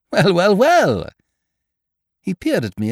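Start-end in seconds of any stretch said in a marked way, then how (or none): 0.1-1.1 high, loud, delighted exclamation; jovially thrilled to see him; hearty greeting. A narrator's reading. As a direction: greet a long-lost pal with gusto. Greeting friend met by chance.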